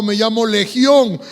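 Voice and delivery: loud, drawn-out name, lightly acted; possessed man answering. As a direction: answer forcefully as the many demons, lightly voiced. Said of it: "el hombre le contestó". (endemoniado respondiendo con fuerza, levemente actuado)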